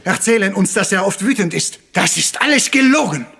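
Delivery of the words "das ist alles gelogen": accusing outburst. Acted. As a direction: slam down the lie. Detective unmasks the lying murderer.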